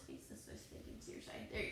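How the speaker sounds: whispered, quick instruction; hushed aside. Real, not acted. Teacher turning a child to face properly, whispered aside.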